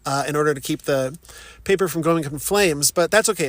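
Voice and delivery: usual speaking voice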